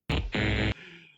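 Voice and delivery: big loud cackling laugh. Real, not acted. Host cracking up at the guess hag. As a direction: crack up loudly.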